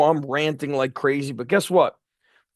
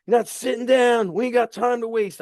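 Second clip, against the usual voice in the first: retold yell; 9 semitones higher than usual. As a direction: yell at him to get off the bench. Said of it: "I'd be like".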